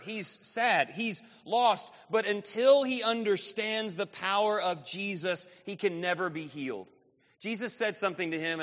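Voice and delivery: ordinary delivery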